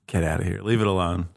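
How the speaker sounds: ordinary delivery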